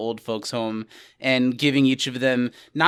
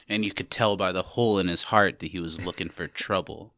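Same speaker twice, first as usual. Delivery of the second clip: noir narrator; 7 semitones lower, 5 dB quieter than usual. Acted. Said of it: "a noir voice starts narrating".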